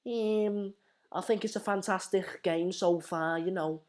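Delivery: put-on high-pitched, slowed-down Scouse accent; playful Scouse voice; Scouse impression. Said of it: "the best impression I can do".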